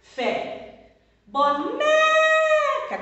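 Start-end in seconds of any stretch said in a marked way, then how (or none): 1.3-3.0 Boneca with NE sung out high, loud, very long; stress on NE. Shown as a demonstration. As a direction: hold the middle syllable of Boneca on a high note. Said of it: "Qual que é a sílaba tônica". (Boneca com o NE bem agudo, forte e prolongado)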